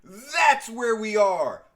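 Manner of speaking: loud, high, slow proclamation; showman's declaration. Pretend. Host closing the recap, loud dramatic declaration. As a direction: belt it out and stretch each word.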